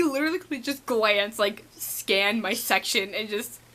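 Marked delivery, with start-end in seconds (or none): none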